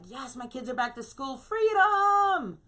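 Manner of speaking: a relieved parent crowing at the end; mock relieved cheer. Parents glad the kids are back at school, building to a high cheer. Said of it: "parents are like".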